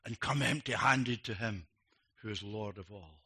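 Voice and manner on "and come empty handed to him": ordinary delivery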